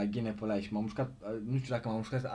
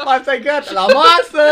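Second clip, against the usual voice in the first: high, loud mock dinner call; 12 semitones higher, 17 dB louder than usual. Host joins the joke, mother calling the dog to dinner, loud mock call. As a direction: shout it across the yard like a mother.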